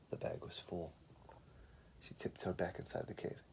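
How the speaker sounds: soft sneaking read-aloud; hushed narration. A narrator's reading. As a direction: hush as if sneaking past a bear. Narrator tiptoes into cave.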